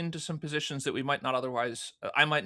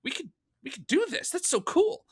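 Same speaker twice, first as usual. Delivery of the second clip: excited inner voice; 10 semitones higher than usual. Retold or imitated. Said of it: "And it's like".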